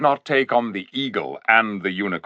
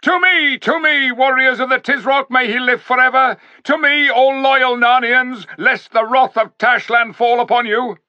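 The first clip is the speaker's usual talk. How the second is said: much higher, louder rallying shout; calling out; 13 semitones higher, 5 dB louder than usual. A narrator's reading. Tarkan calling his soldiers to him, loud shout. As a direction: summon your warriors across the crowd. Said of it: "stood calling out".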